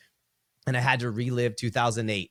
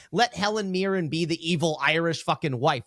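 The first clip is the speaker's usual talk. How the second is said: normal talking voice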